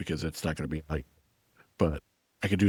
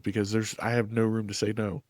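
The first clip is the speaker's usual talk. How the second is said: usual speaking voice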